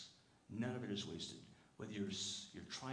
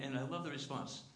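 normal talking voice

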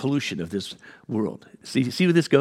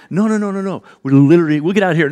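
louder, higher panicked backpedal; flustered protest; 6 semitones higher, 9 dB louder, faster than usual. Retold or imitated. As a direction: hurry to take it all back. Backing down to the driver.

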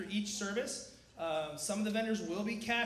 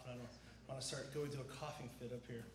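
usual speaking voice

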